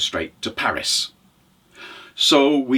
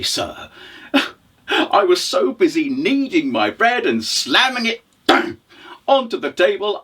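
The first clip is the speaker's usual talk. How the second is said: higher, flustered storytelling voice; startled widow; 6 semitones higher than usual. Acted. Widow to the stranger in her doorway, startled. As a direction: play a woman caught by surprise.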